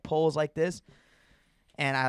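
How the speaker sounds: ordinary delivery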